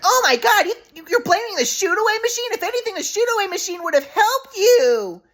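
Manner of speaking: high falsetto mom scolding her son; mocking mom impression. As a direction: mimic mom high and scornful. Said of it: "My mom, I was like".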